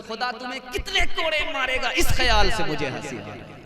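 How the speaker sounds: the maid's answer rising to a loud, bold declaration; loud voiced maid. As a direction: deliver the maid's retort at full volume to the king. Maid answers king after the whipping. (ऊंची आवाज़ में नौकरानी का बेबाक जवाब)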